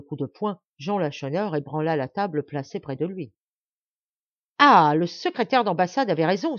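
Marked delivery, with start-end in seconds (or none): 4.6-6.6 loud angry outburst; exclamation. A narrator's reading. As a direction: cry it out loudly, as if suddenly understanding. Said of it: "s'écria-t-il". (s'écrier d'une voix forte)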